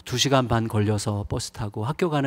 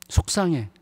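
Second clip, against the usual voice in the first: usual speaking voice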